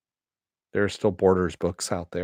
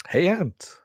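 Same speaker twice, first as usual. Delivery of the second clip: put-on Jackie Mason accent; 9 semitones higher than usual. Vlogger repeating his Jackie Mason impression for viewers. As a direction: toss it off again in a lifted Jackie Mason twang. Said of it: "that was a Jackie Mason impersonation".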